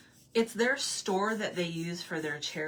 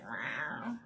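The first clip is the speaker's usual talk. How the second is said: lower, twangy cat meow; imitated cat meow; 5 semitones lower, 4 dB quieter than usual. Imitating Maggie the other cat, low drawn meow. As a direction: meow like a Southern lady cat. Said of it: "And Maggie's like".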